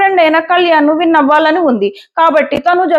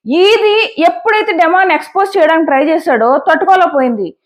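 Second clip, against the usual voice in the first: ordinary delivery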